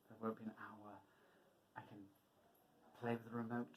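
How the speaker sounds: usual speaking voice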